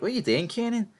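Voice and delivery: high, disbelieving cry; incredulous. Retold or imitated. Recapper reacting in disbelief to Kanan's move, incredulous high cry. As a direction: throw the question up in disbelief. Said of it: "I'm like".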